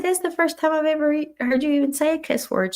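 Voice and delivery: high-pitched quick amazement; retold surprise. Speaker to coworker who never cusses, surprised. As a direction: imitate being taken aback, voice lifted.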